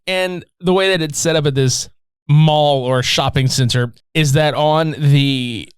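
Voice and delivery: normal talking voice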